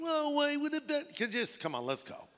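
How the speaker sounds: high, put-on whine; whining. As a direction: mimic a whiner's protest. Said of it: "Gideon is kind of whining".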